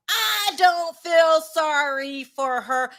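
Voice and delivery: "I don't feel sorry for her" said very slowly and high, word by word; emphatic refusal. Real, not acted. Emphatic refusal of any sympathy.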